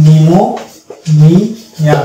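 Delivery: নিউমোনিয়া stretched very slowly, begins on n; drawn-out demo word. Shown as a demonstration. Teacher shows students the word: very slow নিউমোনিয়া. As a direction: draw out নিউমোনিয়া syllable by syllable. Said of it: "পরবর্তী letter এন দিয়ে আমাকে উচ্চারণ করতে হবে".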